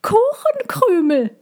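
very high dove call. A narrator's reading. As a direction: pipe it like a thin little pigeon. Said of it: "Taube mit der höheren Stimme".

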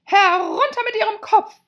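very high, loud shrieking queen; evil queen. A narrator's reading. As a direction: shriek the order like a tyrant queen. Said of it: "sagte sie".

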